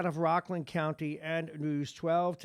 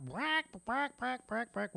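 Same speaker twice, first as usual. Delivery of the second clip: high mock clucking like a hen; chicken clucking; 11 semitones higher, slower than usual. Host mocking the cowardly Senate, high mock chicken clucks. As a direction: cluck at them like a scared chicken. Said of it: "Senate chickened out".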